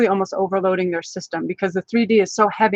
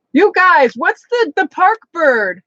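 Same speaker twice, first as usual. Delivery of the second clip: much higher, louder, pleading for help; frantic appeal; 9 semitones higher, 5 dB louder, slower than usual. Real, not acted. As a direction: beg the chat to supply the word. Calling viewers for forgotten word.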